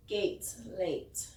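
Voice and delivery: usual speaking voice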